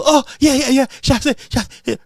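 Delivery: high, excited mock chant of a name; mocking the hacks. Mocking political hacks cheering, high excited mock chant. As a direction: gloat like a cheering party hack. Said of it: "The hacks are gonna do".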